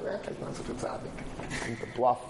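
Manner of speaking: half-whispered, quick and offhand; breathy dismissive brush-off. Retold or imitated. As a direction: wave away the praise in a near whisper. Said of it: "everybody talks about he's a Tzaddik Hadar".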